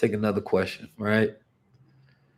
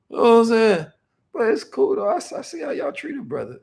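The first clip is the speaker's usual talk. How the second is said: much higher, playful wounded whine; fake-pout at the chat like a slighted friend; mock hurt; 10 semitones higher, 5 dB louder than usual. Streamer teasing chat for not buying badges, high mock-hurt whine.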